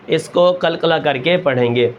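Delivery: usual speaking voice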